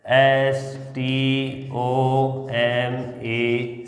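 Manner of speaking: letters drawn out very slowly in a chant; slow sing-song spelling. Shown as a demonstration. Teacher spelling stomach for the class, slow chant.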